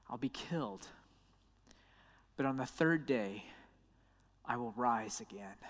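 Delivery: soft, low, grave prophecy; solemn hushed Jesus. Acted. Jesus telling disciples of his death, hushed and grave. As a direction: speak it quietly, weighing each line.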